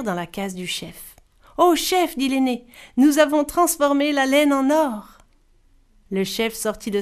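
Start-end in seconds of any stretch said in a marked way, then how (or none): 1.6-5.2 high, loud, out of breath from running; breathless excitement. Acted. Eldest telling the chief, excited. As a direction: burst in with the news. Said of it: "Haletant et dégoulinant".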